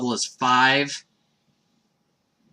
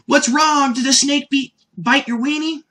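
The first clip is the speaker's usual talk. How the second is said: put-on high, loud, naive kid voice; a high, earnest little-boy voice; innocent Goku; 11 semitones higher, 4 dB louder than usual. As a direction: ask it with wide-eyed innocence. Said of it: "gives one of my favorite lines".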